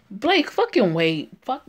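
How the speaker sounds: ordinary talk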